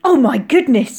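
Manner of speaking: loud, astonished exclamation; wide-eyed astonishment. A narrator's reading. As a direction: exclaim it like a thunderstruck child. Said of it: "his eyes huge".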